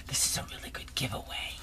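whispering in wonder; awed whisper. Real, not acted. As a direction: breathe it reverently. Whispering over gifts.

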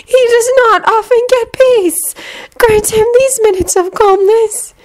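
dreamy and gentle; dreamy distant voice. A narrator's reading. Luna's slow voiced dialogue.

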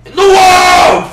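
sudden loud, high yell; triumphant shout. Real, not acted. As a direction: end the boast with a roar. Boasting climax.

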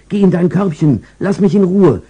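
family too busy for the pet; brushing off the dog. Acted. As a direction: shoo the dog away, no time. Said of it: "wenn alle sagen".

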